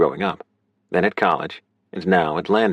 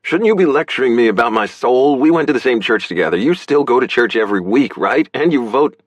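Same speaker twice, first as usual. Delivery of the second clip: loud, higher-pitched challenge; heated, louder outburst; raised voice; 6 semitones higher, 5 dB louder than usual. A narrator's reading. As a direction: fire questions at her, worked up. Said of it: "voice raised enough to startle Ulysses".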